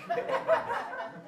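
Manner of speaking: short high-pitched laugh. Real, not acted. Laughs at own retort.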